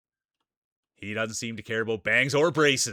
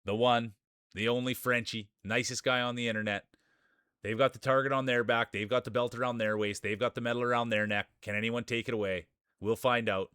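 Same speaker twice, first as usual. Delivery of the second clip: race announcer. Acted. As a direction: call the racers onto the track like a ringside announcer. Calls the marble race start.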